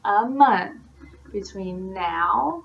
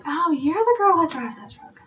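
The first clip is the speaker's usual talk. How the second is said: high imitated exclamation of recognition; acted recognition; 7 semitones higher than usual. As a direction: play someone suddenly recognizing a local. Voices people recognizing the truck.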